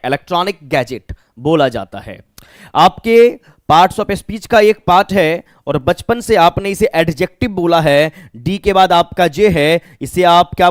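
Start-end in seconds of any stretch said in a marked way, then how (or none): none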